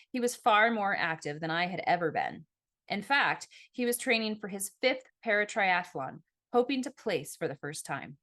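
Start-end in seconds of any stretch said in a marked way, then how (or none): none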